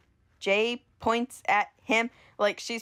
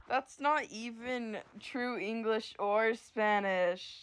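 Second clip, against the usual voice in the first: ordinary delivery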